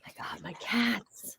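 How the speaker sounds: under-the-breath whisper. Real, not acted. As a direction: hush it, exasperated. Cats making chaos.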